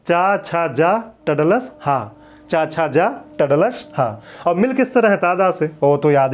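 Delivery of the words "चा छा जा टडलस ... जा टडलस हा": very slow, each syllable set apart; slow chant-along mnemonic; slow recital. Shown as a demonstration. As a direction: drill the rhyme slowly so students can repeat. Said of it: "बोल कर देखिए".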